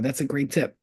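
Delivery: normal talking voice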